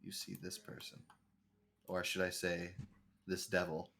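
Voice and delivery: low, hushed ominous narration; dramatic reveal. Acted. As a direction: drop to a sinister whisper for the reveal.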